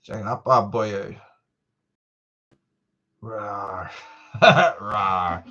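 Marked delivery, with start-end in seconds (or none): 3.2-5.4 dinosaur roar. Pretend. Vlogger shows viewers his realistic dinosaur sounds, mock roar. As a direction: give a goofy low rawr like a toy dinosaur. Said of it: "my realistic dinosaur sounds".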